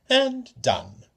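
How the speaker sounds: high, loud and grand; showman flourish. Acted. Klepnos to the family, high and loud flourish. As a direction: finish it like a magic trick.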